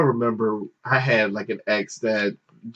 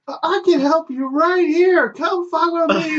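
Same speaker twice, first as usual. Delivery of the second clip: very high, sing-out helper voice; loud girl clerk; 3 dB louder than usual. Retold or imitated. Clerk loudly leads embarrassed shopper away, high-pitched. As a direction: imitate an over-eager clerk calling out sky-high. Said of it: "And she goes".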